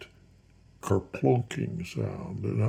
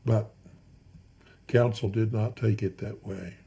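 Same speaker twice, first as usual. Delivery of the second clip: ordinary delivery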